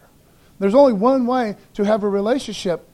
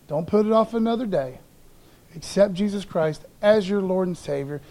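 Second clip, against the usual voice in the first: normal talking voice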